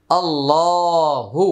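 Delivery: আল্লাহু held long, quick short hu at the end; drawn-out Allahu. Shown as a demonstration. Teacher modelling Allahu slowly for students. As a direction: stretch আল্লাহু slowly for the class. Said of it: "হা পেশ".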